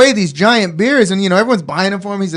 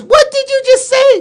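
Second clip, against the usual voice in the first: much higher, louder, outraged question; indignant outburst; 5 dB louder than usual. Retold or imitated. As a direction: fire it back in disbelief. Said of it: "he goes".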